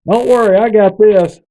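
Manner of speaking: high, cocky can-do promise; confident reassurance. Retold or imitated. Friend volunteering to fix the blackout, cocky high promise. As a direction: toss it off like you've got it handled.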